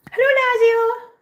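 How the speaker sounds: very high, loud hello to a kitten; pet greeting. Real, not acted.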